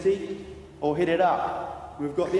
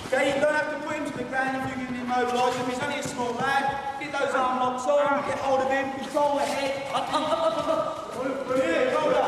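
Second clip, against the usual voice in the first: shouted commands; 7 semitones higher than usual. Real, not acted. Instructor barks orders during drill.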